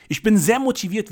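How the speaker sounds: high, loud and energetic; motivated. Real, not acted. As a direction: sell it with full energy. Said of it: "wie du heraushörst". (hörbar motiviert, laut und hoch)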